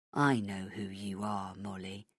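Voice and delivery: soft, low whispered threat; menacing whisper. Acted. Villain taunting Molly, whispered and low. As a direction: breathe it at her, menacing.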